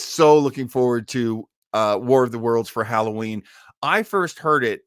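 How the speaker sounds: ordinary talk, nothing marked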